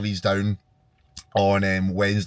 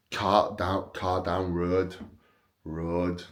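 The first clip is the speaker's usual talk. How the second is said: put-on Leeds accent; 3 semitones lower, 6 dB quieter, much slower than usual. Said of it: "What else can I say in a Leeds accent?".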